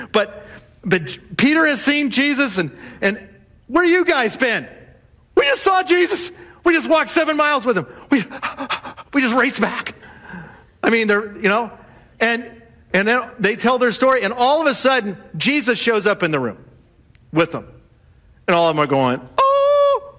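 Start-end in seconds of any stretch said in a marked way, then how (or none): 3.7-10.0 much higher, breathless rush of news; excited disciples. Retold or imitated. 19.4-20.2 very high shocked oh; startled gasp. Retold or imitated. All react to Jesus appearing.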